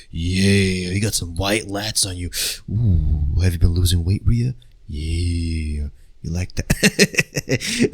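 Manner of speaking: low, soft creepy come-on; hammed-up sleazy seduction, low; mock creepy flirt. Vlogger mocking a creep with Rhea, low soft sleazy voice.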